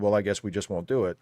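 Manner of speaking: normal talking voice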